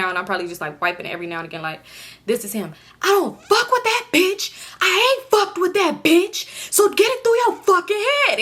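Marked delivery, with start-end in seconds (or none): 3.0-8.4 high, loud, slow angry yell; retold yelling. Boyfriend yelling at girlfriend at the bus stop, high angry shout. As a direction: bark it at her across the bus stop. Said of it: "And he yelling at me".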